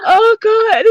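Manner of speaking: high, helpless giggly wheeze; laughing to tears. Real, not acted. Friend telling of the sock interview, laughing till crying. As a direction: barely get it out for laughing. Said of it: "So, I'm crying".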